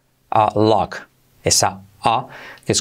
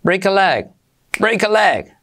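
higher, quick natural break a leg; normal speed; 7 semitones higher than usual. Shown as a demonstration. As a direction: say it as natives do. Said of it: "velocidad normal sería así". (velocidad normal, ligada)

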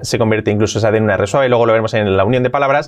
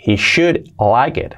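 He will like it drawn out slowly; for learners to copy; slow model; much slower than usual. Shown as a demonstration. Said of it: "slow model".

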